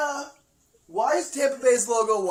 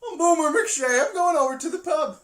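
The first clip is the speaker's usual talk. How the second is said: higher, mock Irish drunk voice; Irish drunk cartoon; 7 semitones higher, 3 dB louder than usual. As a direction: ham up a tipsy Irish brogue. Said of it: "like an Irish drunk cartoon character".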